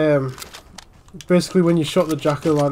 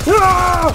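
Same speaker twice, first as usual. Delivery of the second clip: loud, high-pitched cry of "ah"; high yell; 12 semitones higher, 5 dB louder than usual. Real, not acted. Player in a chaotic fight, high yell. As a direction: let out a sudden high yell.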